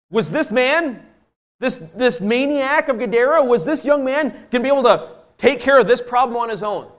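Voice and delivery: high, loud rhetorical question; impassioned preaching. Real, not acted. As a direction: press the question hard on the congregation.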